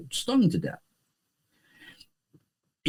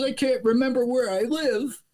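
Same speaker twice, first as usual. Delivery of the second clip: much higher, bewildered mock old man; old man punchline; 11 semitones higher than usual. Teller voices the old man's punchline, much higher. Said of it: "He says".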